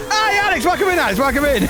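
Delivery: high cheery welcome; excited greeting. Real, not acted. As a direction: greet a friend brightly. Greeting a viewer.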